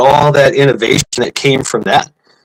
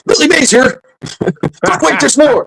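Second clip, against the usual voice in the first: Billy Mays pitchman voice. Retold or imitated. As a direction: sell it like a TV pitchman. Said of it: "No, I'm Billy Mays".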